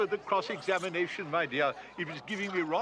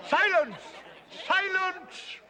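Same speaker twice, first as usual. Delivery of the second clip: shouting; 8 semitones higher, 3 dB louder, slower than usual. Acted. King demands silence in court.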